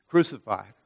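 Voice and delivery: ordinary talk, nothing marked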